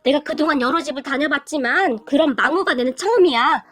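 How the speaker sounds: ordinary delivery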